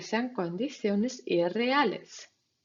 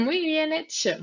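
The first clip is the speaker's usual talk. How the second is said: laughing praise; 7 semitones higher, 6 dB louder than usual. Acted. Praising students after test.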